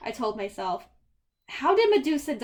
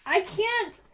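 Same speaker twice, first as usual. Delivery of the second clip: alarmed protest; 7 semitones higher, slower than usual. Acted. Percy refusing in alarm.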